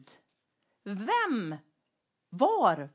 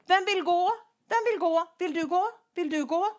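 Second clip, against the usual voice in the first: God calling out; 8 semitones higher, 4 dB louder than usual. Acted. God asking who will go.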